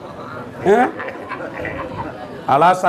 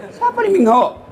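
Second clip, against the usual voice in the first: baffled question. Retold or imitated. Clueless cleric hears a pop star name.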